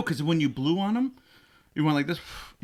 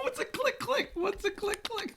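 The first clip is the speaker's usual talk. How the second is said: squeaky mock question; 8 dB quieter than usual.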